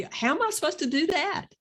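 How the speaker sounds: high, fast, puzzled question; soft no. Shown as a demonstration. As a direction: ask it lightly as a gentle refusal. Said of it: "That's a soft no".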